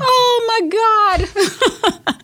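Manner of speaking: high, loud, drawn-out cry of horror; exasperated wail. Real, not acted. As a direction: wail it out, stretching every word, high and loud. Exclaims at imagining an unwanted pregnancy.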